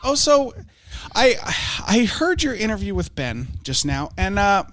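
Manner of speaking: solemn cable news host; Tucker Carlson impression. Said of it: "I am Tucker Carlson".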